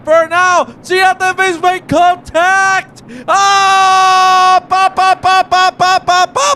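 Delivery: loud, high shout of disbelief; excited race commentary. Real, not acted. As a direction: yell the crash call, drawing words out. Commentator erupts at opening crash.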